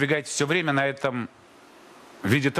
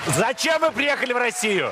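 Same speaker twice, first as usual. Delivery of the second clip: raised, high shouted question; to someone hard of hearing; 9 semitones higher than usual. Real, not acted. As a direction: shout it so a deaf ear catches it. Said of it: "Буду кричать громче".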